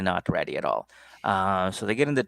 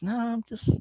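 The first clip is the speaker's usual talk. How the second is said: put-on groggy boyfriend; 13 semitones higher, 3 dB quieter than usual. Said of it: "He's like".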